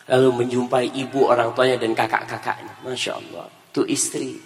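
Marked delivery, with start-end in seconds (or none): none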